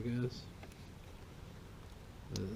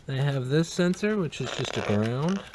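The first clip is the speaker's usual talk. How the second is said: loud, higher, fed-up explaining; exasperated; 6 semitones higher, 8 dB louder than usual. Real, not acted. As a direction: vent frustration at the heater. Exasperated about wrong sensor.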